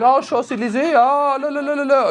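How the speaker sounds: high, airy French babble; mock French. Comic replaying the woman's French act, high mock-French voice. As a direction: trill it high like a cartoon Frenchwoman. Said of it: "She was like".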